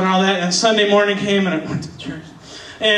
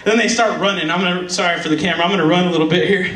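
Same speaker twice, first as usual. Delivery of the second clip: ordinary talk, nothing marked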